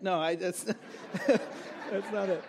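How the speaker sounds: breaking into a chuckle while talking; laughing. Real, not acted. Preacher retracts his own joke to the congregation, laughing. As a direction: take it back through a laugh.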